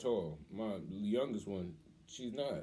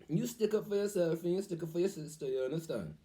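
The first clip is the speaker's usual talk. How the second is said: put-on higher voice, his father's accent all through; Pops' accent; 7 semitones higher than usual. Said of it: "You with his accent".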